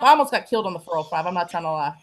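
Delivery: normal talking voice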